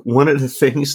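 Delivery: ordinary talk, nothing marked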